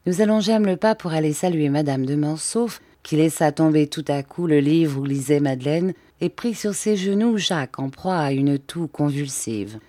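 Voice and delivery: usual speaking voice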